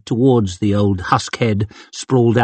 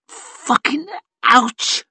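much higher, slow, strained in pain; through gritted teeth; 12 semitones higher, much slower than usual. A narrator's reading. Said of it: "she forced through gritted teeth".

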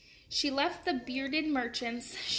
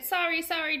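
higher, louder apology; shouted apology; 5 semitones higher, 3 dB louder than usual. A narrator's reading. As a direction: keep shouting sorry as you pass. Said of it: "Jack kept shouting".

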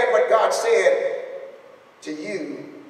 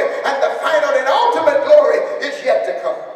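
loud, higher preacher's call, swelling on Abraham Isaac Jacob; preaching at full force; 5 semitones higher, 3 dB louder than usual. Real, not acted. Pastor assuring the grieving family, rousing preaching. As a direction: carry the church on each line.

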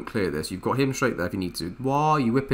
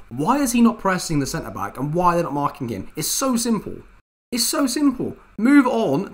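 exasperated, higher and sharper; annoyed outburst; 8 semitones higher, 4 dB louder than usual. Real, not acted. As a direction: vent real frustration at the players. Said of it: "Apologies for my annoyance coming out now".

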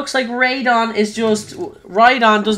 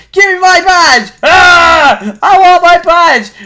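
high, loud hammed-up demand; mock tantrum; 9 semitones higher, 7 dB louder, slower than usual. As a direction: yell like a spoilt child. Demands the stolen badge.

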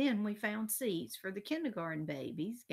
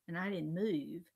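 usual speaking voice

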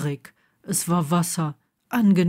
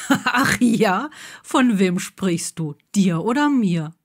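teasing, still laughing; 3 dB louder than usual. A narrator's reading. Neville teasing Blaze back, playful and amused. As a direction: toss the flirt back with a grin. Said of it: "lachte Neville wieder und meinte".

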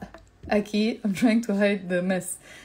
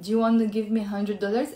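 ordinary delivery

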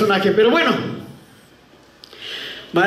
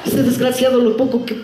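high, insistent mock tirade; hammed-up nagging rant; scolding landlady; 6 semitones higher than usual.

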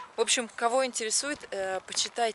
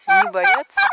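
high startled squeal, much louder; surprised squeal; 13 dB louder than usual. Real, not acted. Squeal at pecking birds.

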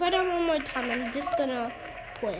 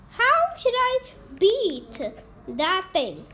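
ordinary talk, nothing marked

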